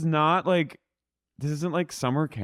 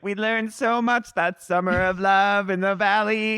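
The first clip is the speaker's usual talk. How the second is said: high, slow, drawn-out mimicry; mock her reminiscing, stretched and pitched up; mocking imitation; 7 semitones higher, 3 dB louder, slower than usual. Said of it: "She's like".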